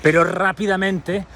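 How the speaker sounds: ordinary delivery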